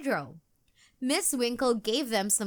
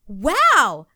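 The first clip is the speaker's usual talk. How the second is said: joking; 3 semitones higher, 10 dB louder than usual. A narrator's reading. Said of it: "joked Jojo".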